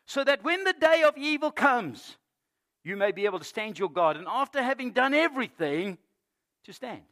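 high, loud, emphatic sermon delivery; full-force preaching. Real, not acted. Preacher urging the congregation to stand against evil, full-force preaching. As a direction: ring it out to the whole congregation.

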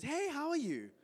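a cheery colleague saying hi; high chirpy hello; friendly greeting. Retold or imitated. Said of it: "Jethro comes up to you and says".

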